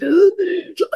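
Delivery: sharp high gasping breath in; mock breathless gasp; imitated gasp. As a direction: gasp for air as on the climb. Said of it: "au niveau respiratoire".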